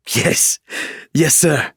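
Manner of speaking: overexcited soldier snapping off a salute; manic excitement. Acted. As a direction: burst out like a pilot running on adrenaline. Manic traumatised pilot saluting.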